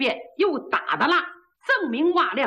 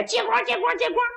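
高声吆喝; 8 semitones higher than usual. Acted. 举刀人喊.

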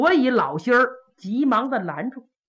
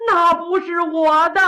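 put-on higher, whimpering old villain denying everything; whining denial; 7 semitones higher, 4 dB louder than usual. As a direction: plead innocence with a half-sob. Accused denies in court.

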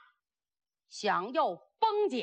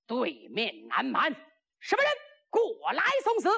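battlefield challenge. Acted. Enemy general challenges across battlefield.

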